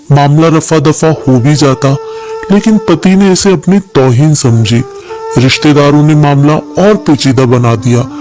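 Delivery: plain reading voice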